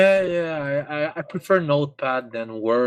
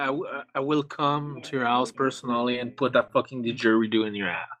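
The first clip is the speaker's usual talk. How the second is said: usual speaking voice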